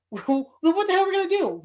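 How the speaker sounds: fast, high frantic question; hammed-up fear; panicked voice. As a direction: rush it in panic. Panicked character voice.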